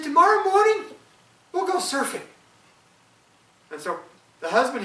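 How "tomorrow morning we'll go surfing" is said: wife, far higher and much louder; the wife's high, loud verdict. Acted. Wife voiced in folk tale.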